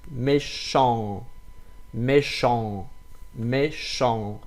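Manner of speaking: Méchant ending in a nasal ang; nasal French vowel. Shown as a demonstration. Teacher repeating méchant for learners, nasal ending. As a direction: end méchant through the nose. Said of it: "but from nose".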